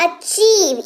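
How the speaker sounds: "Achieve" with a long, pressed ची; stressed long ee. Shown as a demonstration. Teacher showing the ee in "achieve", stressed long. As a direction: lean on the ee in "achieve". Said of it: "ईकार की मात्रा का इस्तेमाल हो रहा है".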